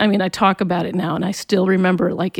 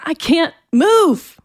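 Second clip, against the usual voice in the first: high, slow, frustrated protest; retold anger; 9 semitones higher, 4 dB louder, much slower than usual. Teenager pinned to the floor, retold angry frustration. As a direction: act out straining furiously, every word drawn out. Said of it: "And I was so mad".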